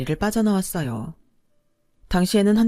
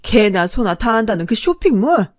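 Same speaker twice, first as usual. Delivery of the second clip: high, loud, disdainful interrogation; contemptuous mother; 5 semitones higher, 7 dB louder than usual. A narrator's reading. Mother sneering at the girlfriend's business and schooling, contemptuous. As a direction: look down on her with open scorn. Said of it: "멸시에 찬 눈빛으로".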